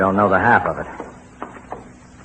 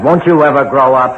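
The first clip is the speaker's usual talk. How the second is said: louder, exasperated rebuke; scolding; 4 semitones higher, 5 dB louder than usual. Acted. Husband scolds wife for yelling, exasperated. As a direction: scold her like a child.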